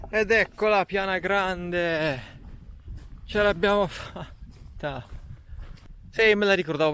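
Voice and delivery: slow, triumphant, high arrival; excited. Real, not acted. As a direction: announce the summit proudly. Arriving at the summit plain.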